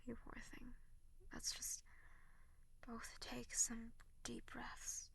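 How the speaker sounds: slow whispered breathing guide; calm them down, slow and hushed; soothing whisper. Acted. Guiding deep breaths.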